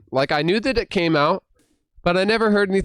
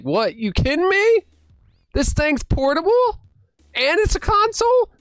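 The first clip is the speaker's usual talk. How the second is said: high-pitched incredulous questions, climbing higher; amazed disbelief; 12 semitones higher than usual. Retold or imitated. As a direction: re-enact being floored by the news. Acting out his amazed disbelief.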